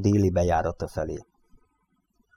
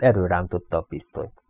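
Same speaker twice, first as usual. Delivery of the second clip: ordinary delivery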